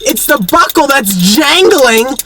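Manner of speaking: hammed-up, high-pitched outburst; heated. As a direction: wail each word in mock exasperation. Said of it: "it got very heated in this situation".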